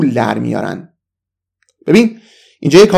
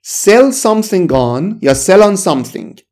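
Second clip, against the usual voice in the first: slow model reading; 4 semitones higher, much slower than usual. Shown as a demonstration. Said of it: "یک phrasal verb داریم".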